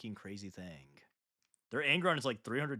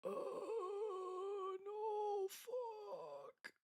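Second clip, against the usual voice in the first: whining; 11 dB quieter, much slower than usual. Real, not acted. Ruined the skin by glazing.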